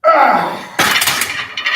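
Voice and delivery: loud, high straining grunt; effort shout. Real, not acted. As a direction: push through the last rep. Straining under a heavy bench.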